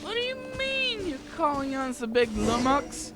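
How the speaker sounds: ordinary talk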